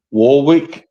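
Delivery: "Warwick" with the second w sounded, WAR-wick; spelling pronunciation. Shown as a demonstration. Host unsure of the university's name, spelling pronunciation. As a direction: say "Warwick" as it is spelt, questioning. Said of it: "How do you pronounce it?".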